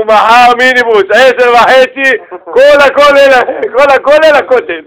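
loud, high, drawn-out group announcement; rally the whole crowd, stretching the words; excited rallying shout. Retold or imitated. Excited kollel men rally everyone. (הכרזה נלהבת בצעקות מתמשכות)

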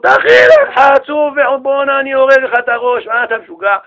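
high, loud outburst easing off; acted furious father; outraged king. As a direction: burst in scolding, hammed-up. King bursts in on son being whipped.

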